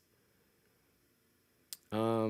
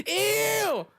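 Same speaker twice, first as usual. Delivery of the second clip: high-pitched squeal of disgust; 4 dB louder than usual. Real, not acted. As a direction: shriek it, grossed out. Reacts to Downey reveal.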